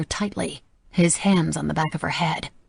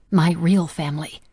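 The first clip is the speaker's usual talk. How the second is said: usual speaking voice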